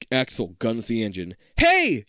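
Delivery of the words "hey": loud, high Hey!; yell. Acted. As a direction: shout in alarm. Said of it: "you yell".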